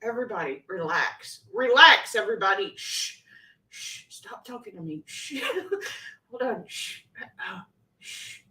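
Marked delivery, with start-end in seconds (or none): 2.8-8.4 shushing. Real, not acted. Hushing herself and everyone.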